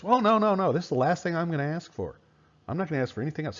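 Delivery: high, protesting voice, running fast from the second line; mock Hitler protest. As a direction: play the dictator swearing it is his last demand. Teacher voices Hitler's false promise.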